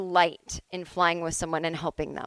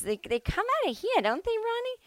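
much higher imitated mom voice, breezy; mom asking dad. Mom asks husband Ronnie about the bombs, high mock voice. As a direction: toss the question to her husband lightly. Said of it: "She'd go".

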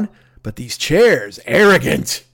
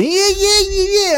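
Pesci impression; slower than usual. Jim Breuer starting his Pesci on the housemate, squealed high. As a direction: squeal the yeahs like a hammed-up Pesci. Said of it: "he starts at the".

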